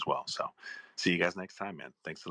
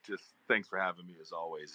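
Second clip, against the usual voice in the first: normal talking voice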